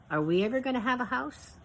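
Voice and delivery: put-on child's hopeful question. A narrator's reading. Child asks mother hopefully.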